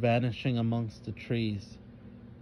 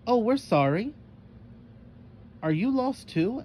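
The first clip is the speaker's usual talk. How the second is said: put-on high, startled girl; 11 semitones higher, 3 dB louder than usual. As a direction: jump up high, caught off guard.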